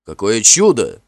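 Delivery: громкое восторженное восклицание; Финн ликует, громко и радостно; радостный возглас. A narrator's reading. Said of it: "радостно воскликнул Финн". (радостно воскликнуть)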